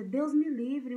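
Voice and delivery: a loud, horrified "no way"; alarmed refusal. Retold or imitated. Mother refusing her child the skates, loud horrified exclamation. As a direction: blurt it out like a scared parent.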